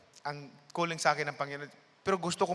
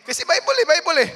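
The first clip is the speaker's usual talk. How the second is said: much higher and louder, bursting with glee; excited; 12 semitones higher, 11 dB louder than usual. Real, not acted. Explaining to listeners why he was glad, high giddy outburst. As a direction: squeal it out, giddy. Excited laughing about Bible study.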